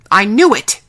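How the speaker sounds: loud, startled exclamation; sudden outburst. Acted. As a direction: burst in loudly with it. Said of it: "startling us all".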